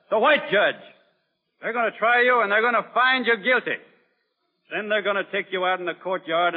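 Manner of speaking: frighten them with what is coming; threatening harangue. Acted. Doctor warning mutinous guards they will hang, forceful threat.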